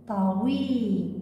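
طوي stretched slowly; slow read of طوي; slow blending. Shown as a demonstration. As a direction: draw طوي out sound by sound. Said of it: "أقرأ، ط،".

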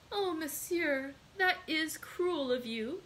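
high, slow, wounded reproach; tearful-leaning young woman's plaint; much affected. A narrator's reading. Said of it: "much affected".

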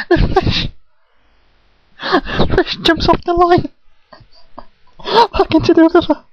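words squeezed out through helpless real laughter; barely holding it together; laughing. Real, not acted.

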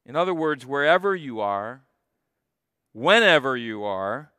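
ordinary talk, nothing marked

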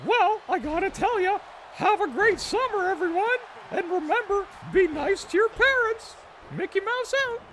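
very high squeaky falsetto, cheery; Mickey Mouse. Acted. Streamer repeating the liked impression for viewers, cartoon mouse falsetto. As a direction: address the fans as a cartoon mouse. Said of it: "someone liked the impression of Mickey Mouse".

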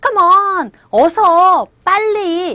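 impatient hurry-up call; urging hurry. Acted. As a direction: hurry them along. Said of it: "뭔가를 재촉할 때".

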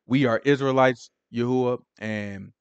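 soft, solemn mock-devout Hebrew voice; a hint of a devout Israelite; mock Hebrew piety. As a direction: lightly put on, hushed and pious. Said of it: "We talking like that".

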